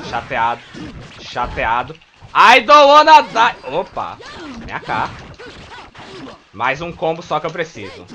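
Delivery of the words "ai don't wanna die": high, loud, slow whine of "don't wanna die"; mock dying cry. Streamer mimicking the fighter as he goes down, high drawn-out wail. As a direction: stretch it out like a beaten fighter.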